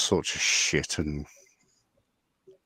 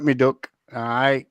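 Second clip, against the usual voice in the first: raised, mock Sheffield greeting; Sheffield accent; 6 semitones higher, 3 dB louder than usual. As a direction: put on a broad Sheffield accent. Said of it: "so you know that Sheffield accent".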